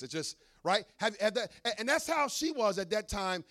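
ordinary talk

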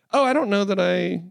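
hammed-up dawning worry; mock self-doubt. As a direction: play suddenly unsure of yourself. Acts sudden self-doubt.

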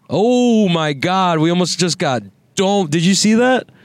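real startled exclamation at a near miss; startled alarm. Real, not acted. Driver reacting to a near crash behind, startled. Car behind almost hits them.

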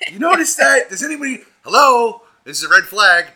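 high, loud, mock-alarmed calling out. Real, not acted. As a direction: call it out like a warning. Mocking alarm about religious groups.

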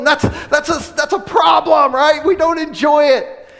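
preaching at full force. Real, not acted. Preacher building to the congregation, loud and high. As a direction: drive it home to the congregation.

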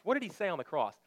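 normal talking voice

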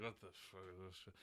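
low, quiet, fast gruff mutter; low gruff Logan voice. Retold or imitated. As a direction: grumble it like a jaded old fighter. Voicing Logan reading comics.

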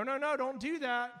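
ordinary talk, nothing marked

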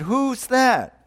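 high, drawn-out call upward; acted calling up. Hanging man in a joke asks the unseen voice, calling out.

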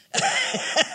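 real squealing laugh; short high burst of laughter. Real, not acted. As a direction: giggle high and loud. Laughing at her story.

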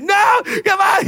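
high-pitched whining outcry; mock protest. Churchgoer unexpectedly called on stage, mock high protest. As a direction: protest high, as if dragged up against your will.

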